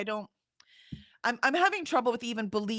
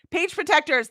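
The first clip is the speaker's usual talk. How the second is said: higher and louder, eureka; triumphant recall; 7 semitones higher, 4 dB louder than usual. Real, not acted. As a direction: pop out Page protectors like a found answer. Finds the word she wanted.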